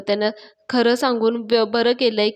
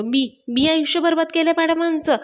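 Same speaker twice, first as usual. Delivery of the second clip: higher-pitched, mildly outraged question; indignant retort; 7 semitones higher, 3 dB louder than usual. Retold or imitated. Raja answers the charge of ruining madam's life, indignant. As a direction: throw the accusation back in disbelief.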